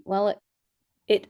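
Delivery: normal talking voice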